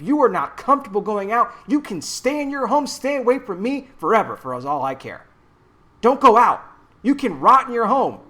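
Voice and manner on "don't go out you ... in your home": loud, angry scorn; angry shout. Real, not acted. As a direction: throw it at them angrily and loudly. Angry rant at scared people.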